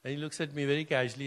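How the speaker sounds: ordinary talk, nothing marked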